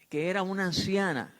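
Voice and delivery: usual speaking voice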